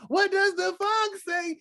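put-on high excited child asking; kid voice. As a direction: ask it like an eager kid. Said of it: "the kids coming to me".

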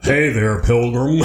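put-on American cowboy accent. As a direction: play an old-Western American accent. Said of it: "an American accent?".

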